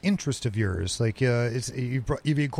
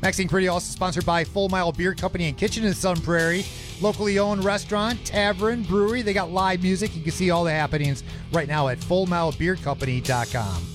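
energetic DJ read, a bit higher; radio announcer; 4 semitones higher than usual. Acted. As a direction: hype the sponsors like a radio host. Host back from break, sponsors.

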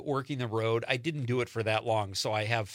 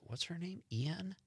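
puzzled quiet question; 5 semitones higher, 11 dB quieter, slower than usual. Retold or imitated. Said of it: "he goes".

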